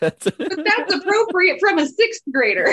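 high, loud, giggly; high slow laughing defense. Real, not acted. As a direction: say it while laughing. Laughing reply.

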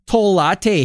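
put-on high, fast Canadian accent teasing about Timmy's; playful Canadian accent; mock Canadian accent.

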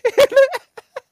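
shrieking laugh; cracking up mid-story; high squealing laugh. Real, not acted. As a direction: burst out laughing at yourself. Laughing at own awkwardness.

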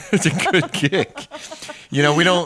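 laughing through the words. Real, not acted. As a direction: say it while laughing. Laughing after the wine joke.

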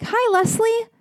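high chirpy barista voice; cheerful barista. Retold or imitated. Barista cheerfully mispronouncing the name, high and bright. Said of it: "And they were like".

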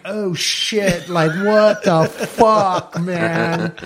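slow, drawn-out shocked swearing with a laugh inside; retold shock. Retelling his panic to the podcast host, slow startled swearing. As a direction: gasp it out like you've just seen a bloody fish. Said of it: "And I'm like".

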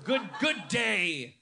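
slow, high laughing Australian "good day", "Struth", "Crikey"; mock Australian. Comedian showing off his Australian accent to the crowd. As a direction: ham up the outback slang. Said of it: "working on my Australian accent".

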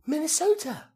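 loud, high excited cry; losing his mind. Retold or imitated. Fan meeting two Minnesotans at a pub, high excited outburst. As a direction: shriek the place name like an overexcited fan. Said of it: "And I lost my mind".

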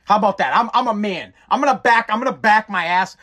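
ordinary talk

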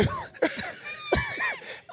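high shrieking laughter; real high howl of laughter. Real, not acted. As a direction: crack up helplessly. Cracking up.

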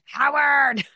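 mock grating mother's call; grating yell. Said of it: "this very grating voice, she yells at him from upstairs".

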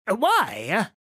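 loud, high shrill call; shrill cry. Acted. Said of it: "a shrill voice cried".

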